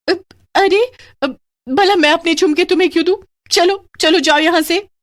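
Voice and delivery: high, fast, shaky refusal; scared defiance. Acted. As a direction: bluster through the fear. Greedy wife refusing witch. (डरी पर ज़िद्दी आवाज़)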